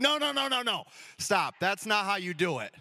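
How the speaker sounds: a child's indignant squeal, hammed up; protesting little girl. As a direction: play the bossy daughter stopping the race. Daughter protesting, voiced by dad.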